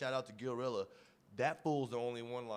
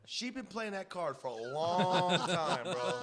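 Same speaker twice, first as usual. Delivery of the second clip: laughing; 6 semitones higher, 6 dB louder, slower than usual. Real, not acted. Laughing at his mom.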